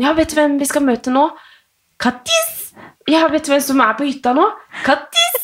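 high, bouncy questions, then Kattis! squealed very high; mother hyping up her daughter about a visit; excited sing-song to child. Retold or imitated. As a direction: build up the surprise for a toddler and burst out the name. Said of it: "hvis jeg sier sånn".

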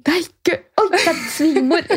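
mortified, laughing at your own horror; real laughter near tears; laugh-crying. Real, not acted. Realising the mother-in-law heard.

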